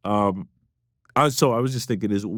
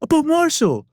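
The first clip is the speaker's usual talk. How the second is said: high, loud mock English accent; 5 dB louder than usual. Label man prompting a forgetful colleague, high English accent. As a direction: exclaim it in a high English impression. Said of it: "doing his, like, English accent".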